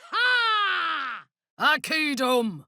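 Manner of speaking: sharp high triumphant "Ha!"; triumphant shout. Acted. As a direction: crow it out high over the battle. Combat bark, triumphant cry.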